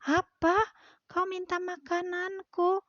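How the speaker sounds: indignant cat. Acted. Cat answers duck indignantly.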